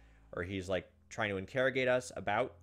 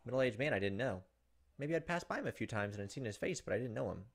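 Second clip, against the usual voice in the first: reading the novel's text with quiet dread; tense story narration; 3 dB quieter than usual. Acted. Streamer reading Higurashi narration to viewers, tense narration. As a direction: narrate it like a creeping horror story.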